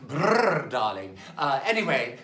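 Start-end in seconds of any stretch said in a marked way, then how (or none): 0.1-0.7 playful shiver brrr. Pretend. Snow Queen shiver noise.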